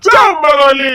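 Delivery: high, drawn-out jeering yell; loud teasing shout. Acted. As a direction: holler the nickname in mockery. Echoes the teasing name-call. (உரக்கக் கிண்டலாகக் கத்தி)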